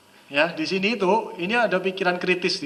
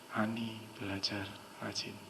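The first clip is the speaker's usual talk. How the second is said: slow, low, very soft suggestion; hushed hypnotic demo; heavy flat intonation; 6 semitones lower, 16 dB quieter than usual. Shown as a demonstration. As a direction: plant the words quietly, without emotion. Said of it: "intonasinya agak berat".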